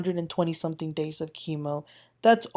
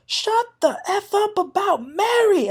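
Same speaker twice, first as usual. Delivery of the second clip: very high, shouted, slow and cracking; Jen Shah furious; 13 semitones higher than usual. Retold or imitated. Jen Shah losing it at a cast mate, furious shout. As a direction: scream it out in full rage. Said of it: "Jen Shah got so mad".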